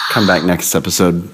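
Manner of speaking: ordinary talk, nothing marked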